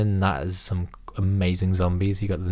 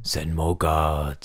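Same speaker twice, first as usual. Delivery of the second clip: zombie voice; 4 semitones lower, 4 dB quieter, much slower than usual. Retold or imitated. Quoting zombie line from film.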